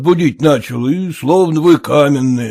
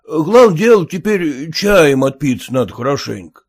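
usual speaking voice

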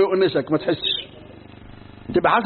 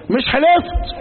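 mock rebuke; 9 semitones higher, 3 dB louder, much slower than usual. God rebukes the broken oath.

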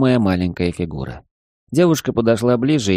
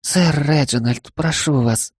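put-on pleading young maid; 6 semitones higher, slower than usual.

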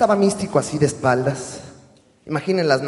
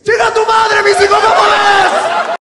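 insulto gritado muy agudo y fuerte; grítale con rabia burlona a todo volumen; insulting shout; 16 dB louder, slower than usual. Retold or imitated. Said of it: "Y el güey le dice". (el señor por fin insulta a Místico, gritando con furia cómica)

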